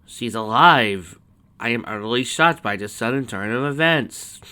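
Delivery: hammed-up astonished announcement; mock shock. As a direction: overplay being utterly shocked. Narrator mocks the sudden twist.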